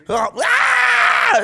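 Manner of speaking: high-pitched mock scream; imitated scream. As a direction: mimic someone shrieking down a group call. Imitating a scream from group call.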